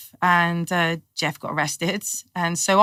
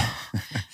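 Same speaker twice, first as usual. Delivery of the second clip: sudden breathless intake of shock; sharp gasp; 10 semitones lower, 11 dB quieter than usual. Retold or imitated. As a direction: gasp as if caught out. Shocked while being marched off.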